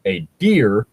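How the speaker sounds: usual speaking voice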